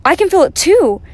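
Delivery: high, loud, thrilled agreement; excited exclamation. A narrator's reading. Harry Bear joins in the Christmas feeling, excited exclamation. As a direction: chime in loudly with delight.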